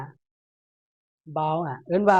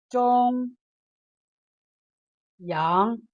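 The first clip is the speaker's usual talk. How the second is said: isolated words, each drawn out carefully with long pauses; model reading for repetition; 4 semitones higher, much slower than usual. Shown as a demonstration. Teacher leads class to read along, careful model pronunciation. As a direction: say each word clearly for learners to repeat. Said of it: "大家再跟老师读一遍".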